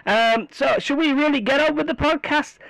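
loud bullhorn voice; through a megaphone. Shown as a demonstration. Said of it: "Now I've got the bullhorn thing".

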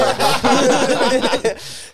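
high loud laugh; real laugh. Real, not acted. Friend cracking up telling the arena story, loud laugh. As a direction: laugh at the memory.

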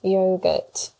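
"yogurt" with long O, yoh-gut; Australian "yogurt". Shown as a demonstration. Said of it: "That's not right".

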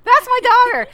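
high, slow mock mom voice; light impression of a proud mother; imitating mom. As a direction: play the proud mother hearing her daughter. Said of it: "She's like".

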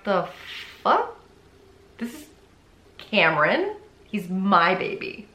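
halting, with long pauses between words; stunned disbelief. Retold or imitated. Mother answering the acquaintance about her baby, stunned disbelief. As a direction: piece it out slowly, imitating a baffled mother.

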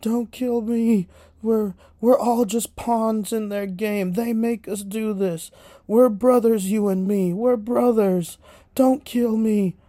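high, loud, panicked pleading; mock desperate begging, stretched out; begging for life. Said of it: "he's like".

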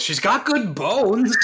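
high, slow, chirpy sales pitch; real estate agent. Acted. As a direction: sell the house with a laugh. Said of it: "Real estate agent voice".

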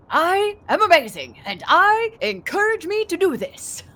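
high, loud, boastful swashbuckler voice; Puss in Boots. Acted. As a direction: proclaim it grandly like a swaggering hero. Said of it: "got this image of Puss in Boots".

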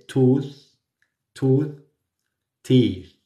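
tooth and teeth with the tongue behind the teeth; tongue-tip th. Shown as a demonstration. Teacher modelling tooth and teeth. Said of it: "poner la lengua detrás de estos dientes".

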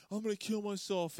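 high-pitched mock threat; hammed-up high, strained plea; imitated desperate man. Acting out suicidal man in skit.

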